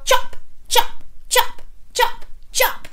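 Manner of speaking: sharp, staccato chopping noises; evenly spaced, punchy chops; scissor chopping. Pretend. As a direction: snip each word short like scissors. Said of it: "pretend you've got scissors in your hand and go".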